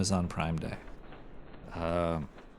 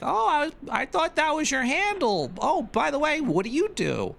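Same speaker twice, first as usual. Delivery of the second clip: put-on very high sing-song girly voice; hammed-up high girly voice offering art; mock anime girl. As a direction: play the eager fake anime girl. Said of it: "They're like".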